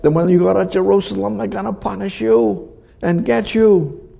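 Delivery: high, fast mock warning to Paul. Friends warn Paul he'll be punished in Jerusalem, urgent high voice.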